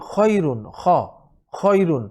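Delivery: খয়রুন with a rasped kh from the throat; throat kha. Shown as a demonstration. Teacher practises খয়রুন with students. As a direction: scrape the খ in খয়রুন. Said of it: "আমরা শব্দটা practice করি".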